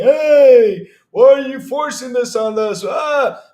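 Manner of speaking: cartoon a shrill complaining fan; mocking whiner. Streamer mocking fans outraged about forced accounts, squealing loud.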